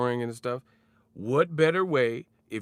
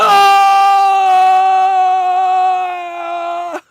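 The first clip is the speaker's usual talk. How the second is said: long screamed no; 16 dB louder than usual. Retold or imitated.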